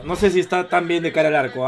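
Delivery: usual speaking voice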